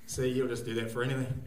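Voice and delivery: ordinary talk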